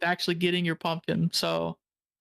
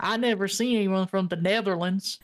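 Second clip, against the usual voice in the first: put-on Southern accent. Owner marvels at the foreign visitor, in a Southern accent. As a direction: mimic a wondering Southern local. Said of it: "He talked like this".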